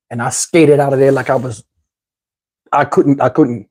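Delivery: ordinary talk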